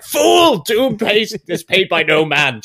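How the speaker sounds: high, loud villain roar; Doctor Doom shouting at a client; Doctor Doom rage. Retold or imitated. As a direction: bellow like an offended tyrant. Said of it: "he's just like".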